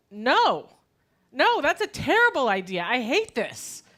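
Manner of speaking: loud, high-pitched protest; vehement inner refusal. Acted. Eight-year-old silently refusing the swim team, vehement protest. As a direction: burst out in dismay, hammed-up. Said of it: "I thought to myself".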